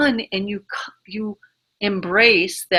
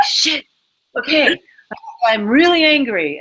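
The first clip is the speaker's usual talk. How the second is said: higher, louder burst of venting anger; frustrated outburst; 6 semitones higher, 5 dB louder than usual. Retold or imitated. To a demanding coach, a louder, higher venting of anger. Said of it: "It's like".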